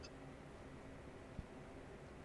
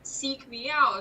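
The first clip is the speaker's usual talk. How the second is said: usual speaking voice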